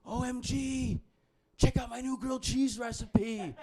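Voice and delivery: high, squealing texter voice; mock gushing. Mocking a text to a pen pal, high mock excitement. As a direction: shriek it like an over-excited texter.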